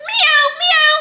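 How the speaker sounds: high meow; cat meowing. Pretend. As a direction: mew like a kitten. Repeats animal sounds.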